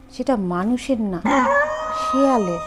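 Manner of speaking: ordinary delivery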